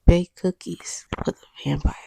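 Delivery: whispered, teasing innuendo; coy euphemism whisper. Real, not acted. Asking viewers a cheeky question about vampires: coy whisper. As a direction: hush it like a naughty secret. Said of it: "I'm kind of curious".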